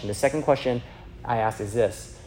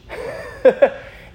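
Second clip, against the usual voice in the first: a quick breathy laugh; short airy chuckle; 11 semitones higher, 6 dB louder than usual. Real, not acted. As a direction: laugh it off lightly. Laughing at own point.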